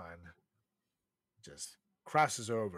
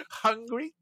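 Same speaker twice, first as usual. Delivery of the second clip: ordinary talk, nothing marked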